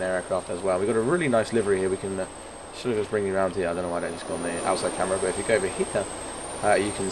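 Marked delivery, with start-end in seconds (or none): none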